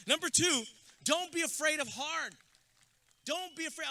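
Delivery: loud, high preacher's build to the graduates; rally the crowd at full force; preaching. Acted. Speaker firing up graduates at commencement, loud preaching.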